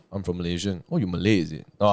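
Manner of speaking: ordinary talk, nothing marked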